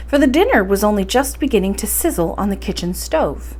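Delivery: ordinary delivery, nothing marked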